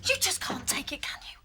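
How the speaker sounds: soft, hushed fury; angry whisper. Acted. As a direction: whisper it through the anger. Hushed argument.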